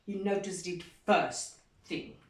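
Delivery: plain reading voice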